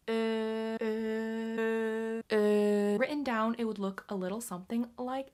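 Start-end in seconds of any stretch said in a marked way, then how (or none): 0.1-3.0 drawn-out ö sounds; Finnish filler ö. Shown as a demonstration. A Finn showing the filler ö. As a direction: hum "Ö, ö, ö" as a Finnish speaker filling a pause. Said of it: "people like to use the phrase".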